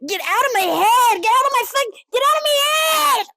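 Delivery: high, escalating hammed-up scream; exasperated yelling. Podcaster banishing influencer's voice, escalating shout. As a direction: yell as if driven mad. Yells at voice stuck in head.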